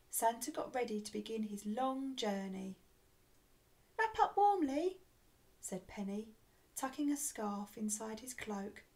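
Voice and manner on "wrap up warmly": high, loud pixie voice. A narrator's reading. Penny seeing Santa off, high pixie call.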